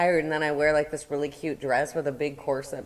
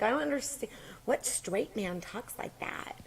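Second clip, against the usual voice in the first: incredulous whisper; 7 semitones higher, 8 dB quieter than usual. Retold or imitated. Wondering aloud how a straight man talks, hushed whisper. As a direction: whisper it in disbelief.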